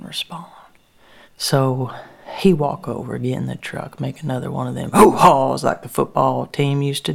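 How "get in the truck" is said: ordinary delivery, nothing marked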